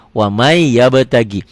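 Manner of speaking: "wa may yabtagi" with a long held Y; merged recitation. Shown as a demonstration. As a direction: stretch "may" slowly into "yabtagi", no N. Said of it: "jadi bacanya wamai".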